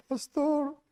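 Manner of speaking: high-pitched tearful cry of pastor; mock tearful plea. Husband crying to the pastor, high tearful plea. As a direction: imitate a weeping husband calling the pastor.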